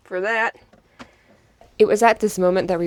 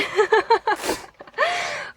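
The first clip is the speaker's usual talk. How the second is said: high burst of giggles; laughing; 14 semitones higher than usual. Real, not acted. Sibling laughing at the weirdest sentence ever, high giggle. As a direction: crack up helplessly.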